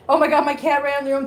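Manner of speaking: usual speaking voice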